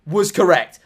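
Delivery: loud and high, vindicated; I told you so energy; excited rant. Real, not acted. Triumphant ranting about being right.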